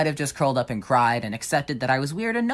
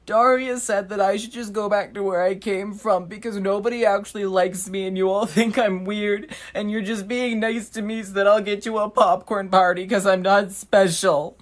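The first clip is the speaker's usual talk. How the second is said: higher, put-on wounded little voice; mock pitiful victim; 7 semitones higher, 4 dB louder than usual. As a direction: play the innocent victim for sympathy. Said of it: "And I was like".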